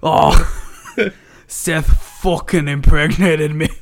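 hammed-up Irish accent slipping into Scottish; botched Irish accent, turning Scottish; [name]'s accent gone Scottish. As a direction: overdo a wobbly Irish-Scottish brogue. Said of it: "It went Scottish".

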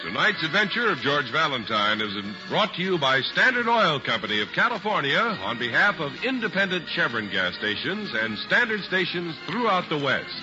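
usual speaking voice

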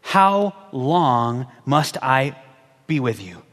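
slow, low, worn-out sigh of frustration; weary Jesus. Acted. Jesus, fed up with the faithless crowd, slow and low. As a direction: drag it out like you've had enough.

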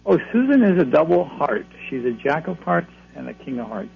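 ordinary talk

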